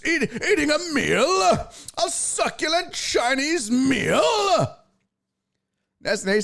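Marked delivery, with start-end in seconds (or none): 0.0-4.2 very high, theatrical mock indignation; hammed-up impression of an outraged orator; Jack Carlson. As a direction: declaim it grandly, stretching every word. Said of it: "I'm doing this accent".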